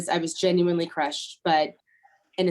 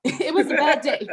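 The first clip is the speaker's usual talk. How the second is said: laughing; 7 semitones higher, 4 dB louder than usual. Real, not acted. Laughing while recalling the day.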